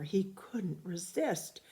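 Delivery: ordinary delivery, nothing marked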